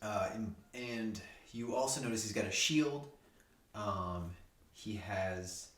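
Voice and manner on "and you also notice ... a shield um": ordinary talk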